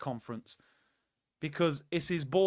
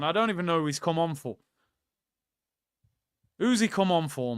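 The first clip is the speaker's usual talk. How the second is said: ordinary delivery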